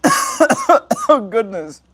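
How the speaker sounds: choked, squeaky gasp through coughs; coughing fit. Real, not acted. Stoner coughing after inhaling, choking out words. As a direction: strains out words between real coughs.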